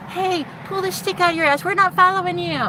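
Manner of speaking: high mock shout; shouting up at them. Shouting at leaders from below, high mock yell. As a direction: call out defiantly from below. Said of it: "I'd shout from down here".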